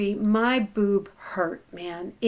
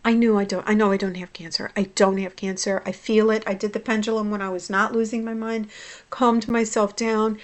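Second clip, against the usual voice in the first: ordinary talk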